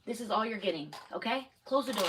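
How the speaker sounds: stern mother voice that wants it done now; firm calling. Real, not acted. Mom calls child over firmly.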